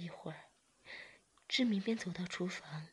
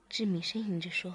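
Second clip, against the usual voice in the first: ordinary delivery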